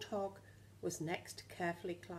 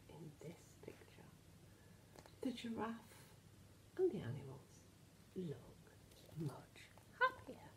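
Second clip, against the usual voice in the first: hushed whisper; 6 dB quieter than usual. Acted. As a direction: share it softly like a secret. Teacher musing to children.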